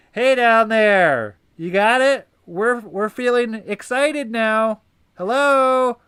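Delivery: high, loud call down to someone far below; calling down loudly. Pretend. Mind shouting down to the body, loud and high. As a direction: shout down the stairs to get their attention.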